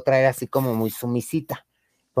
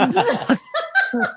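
cracking up at own story; high burst of laughter. Real, not acted. Laughing while telling friends the story.

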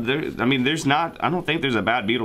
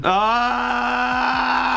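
dismayed shout; 10 semitones higher than usual. Acted. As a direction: cry out in dismay. Dismayed outburst in skit.

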